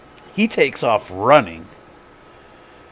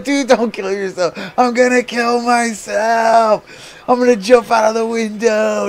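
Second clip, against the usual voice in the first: mocking imitation; 10 semitones higher than usual. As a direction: throw his words back at him. Mimicking brother back mockingly.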